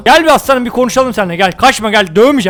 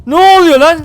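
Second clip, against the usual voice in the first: higher, louder startled yell; real shock as the fight breaks out; excited shout; 7 semitones higher, 6 dB louder than usual. Real, not acted. Caught off guard by the attack, shouting. Sudden battle erupts.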